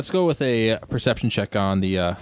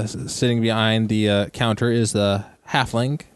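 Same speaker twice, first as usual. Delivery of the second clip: ordinary talk, nothing marked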